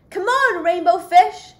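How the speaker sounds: high, loud call to a friend. Acted. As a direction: shout across the water to come play. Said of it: "they would call".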